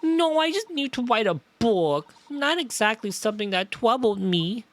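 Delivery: put-on childish voice with r's and l's as w's; small-child voice, confessing; earnest little girl. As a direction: explain haltingly like a small child. Voices the child Iris in game.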